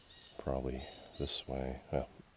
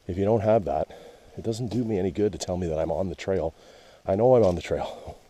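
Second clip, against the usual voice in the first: louder, fed-up complaining about the signs; exasperated rant; 4 semitones higher, 12 dB louder than usual. Real, not acted. Lost hiker complains to camera about the trail posts: loud exasperation. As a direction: vent at the trail markers, half laughing at the absurdity.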